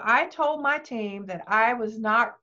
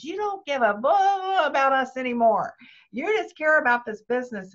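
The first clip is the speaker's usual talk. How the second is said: mild retold anger, a bit higher and louder; angry accusation; 4 semitones higher, 3 dB louder than usual. Husband accusing wife of neglecting family, mildly angry. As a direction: imitate the husband's hurt accusation. Husband confronting her over business.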